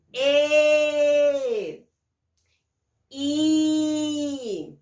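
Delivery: each vowel held long and loud, one by one; drawn-out vowels. Shown as a demonstration. Teacher reciting the vowels slowly and loudly to children. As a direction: stretch out every vowel for the class. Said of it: "Vamos lembrar quais são as vogais e o sonzinho".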